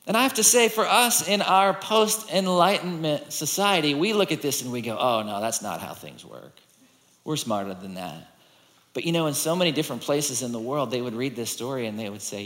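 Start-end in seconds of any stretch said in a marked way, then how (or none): 5.0-8.4 low, soft, knowing aside; dismissive skeptic. Acted. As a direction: brush it off quietly as beneath you. Voicing skeptical modern readers' dismissal.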